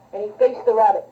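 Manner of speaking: ordinary talk, nothing marked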